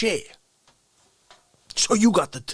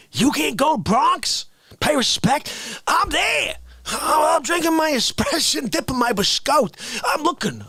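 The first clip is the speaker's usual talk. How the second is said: excited shouting; 8 semitones higher than usual. Acted. Excited storytelling.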